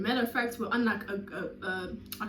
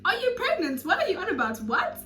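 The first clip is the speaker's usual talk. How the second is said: confused friend; 7 semitones higher, 5 dB louder than usual. Retold or imitated. The friend puzzled by the stepmother news, high and confused. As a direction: sound completely thrown. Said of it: "athi umngani".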